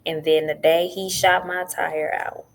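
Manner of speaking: ordinary talk, nothing marked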